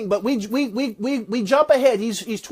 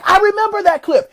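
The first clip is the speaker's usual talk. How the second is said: much higher and louder, thrilled to recall it; excited recognition; 9 semitones higher, 5 dB louder than usual. Real, not acted. Speaker tells the crowd he knows that clip, excited and high. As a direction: burst out with the memory.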